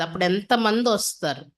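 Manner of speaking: usual speaking voice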